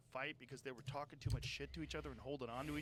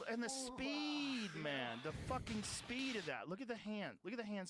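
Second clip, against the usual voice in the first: real awe; 5 semitones higher than usual. Real, not acted. Host marvels at a boxer's hand speed, awed. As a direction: marvel at it, stretching the vowel high.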